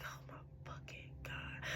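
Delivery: slow, barely audible whisper; whispered dread. Retold or imitated. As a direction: whisper it slowly, as if hiding from someone. Retelling dread as he bangs outside.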